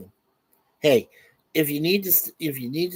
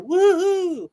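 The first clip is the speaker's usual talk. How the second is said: high, loud mock party whoop; mock woohoo; 13 semitones higher, 4 dB louder than usual. Host mocking wild strip poker guests, whooping. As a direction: whoop like a silly party cheer. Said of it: "start going".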